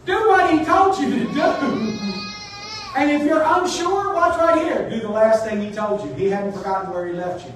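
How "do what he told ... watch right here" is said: high, loud preacher's charge; raised-voice urging, pitched well up; emphatic exhortation. Real, not acted. Preacher charges congregation to obey, loud emphatic exhortation.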